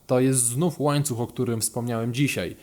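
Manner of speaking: usual speaking voice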